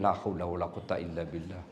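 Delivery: low, soft resigned prayer; husband giving up on nagging wife; weary muttered prayer. Pretend. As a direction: sigh it out in resignation. Weary prayer about nagging wives.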